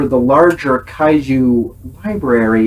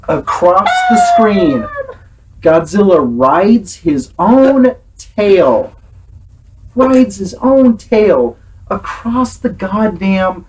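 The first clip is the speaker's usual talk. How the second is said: slow, higher dramatic narration; trailer narration; 4 semitones higher, slower than usual. Acted. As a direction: announce it like a movie trailer.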